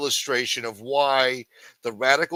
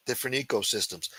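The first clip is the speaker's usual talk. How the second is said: ordinary talk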